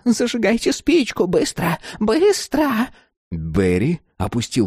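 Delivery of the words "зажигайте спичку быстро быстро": high, frantic crone orders; urgent old woman. Acted. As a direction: hurry him in a high, insistent voice. Urgent order to light match.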